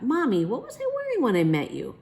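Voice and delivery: put-on child voice. Child asking mother.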